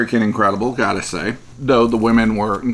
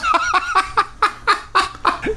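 high burst of laughter. Real, not acted. Laughs at gross men remark.

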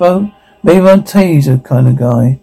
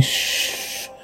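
whisper; 16 dB quieter than usual. Acted. Hushing someone in hiding.

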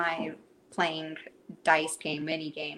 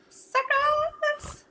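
high, drawn-out "suckers" jeer; gleeful high-pitched child's taunt; taunting kid; 3 dB louder than usual. Retold or imitated. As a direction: imitate a small boy crowing at his sisters. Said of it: "he just says".

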